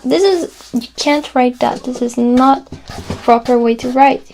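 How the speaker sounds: usual speaking voice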